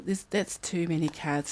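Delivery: normal talking voice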